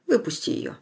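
whispered command; hushed order. A narrator's reading. Father tells son to release her, hushed. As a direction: let her go, quietly.

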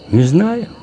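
a hint of a child's high voice; little boy. A narrator's reading. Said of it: "ответил мальчик".

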